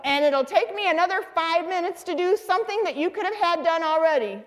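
high-pitched exasperated wife rant; scolding mother. Retold or imitated. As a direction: let fly at your husband, imitating her. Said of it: "And she'd go".

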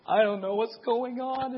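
high, tearful bewildered voice; imitating her crying. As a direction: voice her sobbing confusion. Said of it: "And she's just bawling".

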